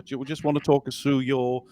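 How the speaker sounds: usual speaking voice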